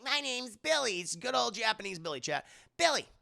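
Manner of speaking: put-on small boy saying his name; little kid voice. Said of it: "I'm gonna call him Billy".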